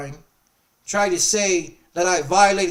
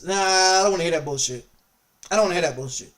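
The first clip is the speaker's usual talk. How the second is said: normal talking voice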